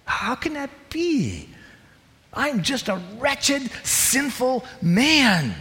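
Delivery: loud, high, slowed, pleading; anguished preaching. Acted. Preacher voicing Paul's self-reproach, loud and anguished. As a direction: cry out in wonder and shame. Preaching builds to full force.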